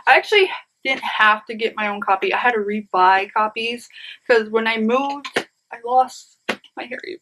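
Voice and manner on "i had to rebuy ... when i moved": usual speaking voice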